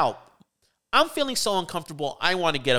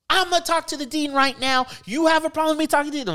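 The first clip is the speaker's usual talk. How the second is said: much higher, very fast, confrontational mock voice; combative threat; 12 semitones higher, faster than usual. Student storming out to the class, high fast combative challenge. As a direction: throw down the challenge on the way out.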